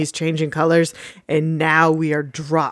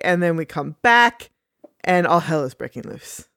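normal talking voice

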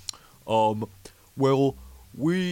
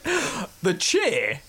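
puzzled clerk; 8 semitones higher than usual. Retold or imitated. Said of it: "So she's like".

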